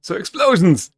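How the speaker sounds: loud eager cheer; gleeful about blowing it up; excited. Real, not acted. Player excited to use bomb.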